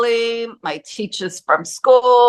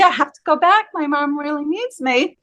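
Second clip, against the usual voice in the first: put-on raised, childlike plea; retold pleading; 5 semitones higher than usual. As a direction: plead gently to be let go, pitched up. Child pleads with guardian angels.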